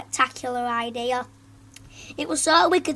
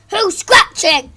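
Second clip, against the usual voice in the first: slow, loud, higher demand; 6 semitones higher, 11 dB louder, slower than usual. Acted. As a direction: snap it at the class. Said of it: "she demanded".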